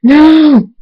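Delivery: high, loud, drawn-out scream. A narrator's reading. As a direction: scream it out in horror. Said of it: "Skulu screams".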